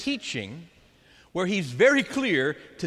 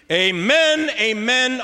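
amen, amen rung out high and loud; solemn loud amen; 5 semitones higher, 7 dB louder, slower than usual. Acted. Jesus solemnly assuring the crowd, loud ringing amen. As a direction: proclaim amen, amen like a solemn vow.

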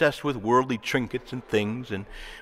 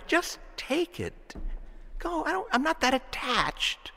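letting it go; 13 semitones higher, 6 dB quieter, slower than usual. Retold or imitated. Generous disciple letting go.

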